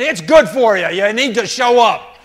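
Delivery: loud, high nagging shout; insistent scolding. Acted. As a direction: override the protest at full volume. Acted woman dragging a reluctant man.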